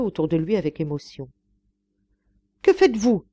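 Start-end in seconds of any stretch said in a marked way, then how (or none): 2.6-3.3 loud, high cry of alarm; horrified cry. A narrator's reading. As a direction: cry out, appalled at the intrusion. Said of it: "s'écria Babette, qui l'avait suivi, épouvantée".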